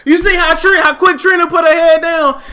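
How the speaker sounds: high, loud, amazed cry; shouting in disbelief at the clip; excited shout. Real, not acted. Vlogger yelling to viewers in amazed disbelief. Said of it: "excited commentary on the clip".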